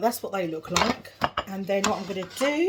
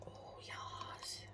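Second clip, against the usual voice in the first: breathy delighted whisper; 22 dB quieter than usual. Real, not acted.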